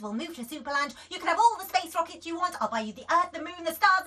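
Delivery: very fast, loud escalating list; breathless gabbling bribes; frantic mum. A narrator's reading. Mum promising the world, frantic. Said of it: "said Daisy".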